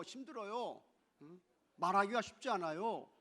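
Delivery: ordinary delivery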